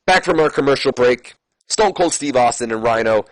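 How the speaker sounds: normal talking voice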